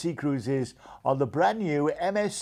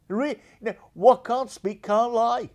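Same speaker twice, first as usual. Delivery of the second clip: ordinary talk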